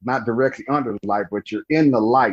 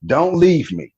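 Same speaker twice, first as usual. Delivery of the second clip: higher, louder pleading cry; desperate plea; 6 semitones higher, 4 dB louder, slower than usual. Retold or imitated. Dying friend Herman begging him, desperate plea. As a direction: beg him not to go.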